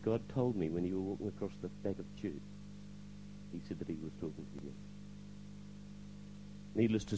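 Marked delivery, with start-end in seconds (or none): 0.0-4.7 very soft, low retold answer; hushed revelation. Pastor explains God spoke to him, hushed revelation. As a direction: confide it quietly, voice dropped.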